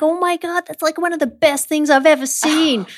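high-pitched retold awe; imitated amazement. Quoting the manager after the catch, amazed.

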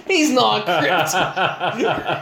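slow, drawn-out mock alien accent, laughing through it; terrible Krypton accent. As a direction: fake an accent from Krypton, badly and slowly. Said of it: "That was a terrible Krypton accent".

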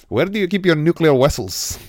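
loud, high-pitched mock Russian accent. Quoting Star Trek's whale film, Russian accent.

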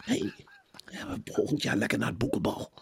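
hushed, whispery promise; mock coaxing publisher. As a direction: dangle the treat in a soft half-whisper. Publisher teases authors with ball tickets.

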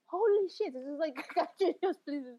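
high, exasperated mock rant; exasperated outburst. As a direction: throw up your hands, pleading. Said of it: "It's like".

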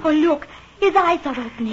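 lady seeing the sick man open his eyes, excited; excited relief. Acted. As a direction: burst out with hope. Patient waking.

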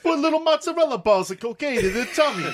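put-on high, loud laughing Italian accent. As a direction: mobster voice, cracking up. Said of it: "doing an Italian voice".

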